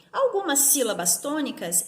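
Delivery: ordinary talk, nothing marked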